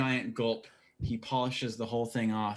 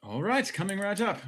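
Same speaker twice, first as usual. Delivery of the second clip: higher-pitched, singsong bartender; 10 semitones higher, 3 dB louder than usual. Acted. Bartender answering an order for a round, higher singsong voice. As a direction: call back the order in a lifted voice. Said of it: "bartender just looks up and says".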